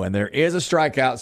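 normal talking voice

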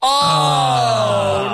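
long, high wail of disappointment; dismayed cry. Real, not acted. Host reacting to the contestant's losing match, dismayed cry. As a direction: draw out a big groan of dismay.

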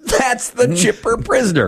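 high, chirpy title call; hammed-up chipper announcer; mock podcast tagline. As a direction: announce it like a cheesy promo.

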